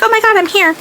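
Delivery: high, gushing mock arrival; mock excited. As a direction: play up a thrilled oh my God. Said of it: "be like".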